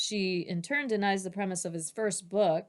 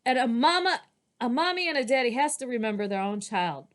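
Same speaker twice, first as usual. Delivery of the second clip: acted child's protest, loud at first, higher after; indignant blurting; 3 semitones higher, 6 dB louder than usual. Child objecting to the teacher about a storybook, blurting indignantly. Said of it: "She blurts out".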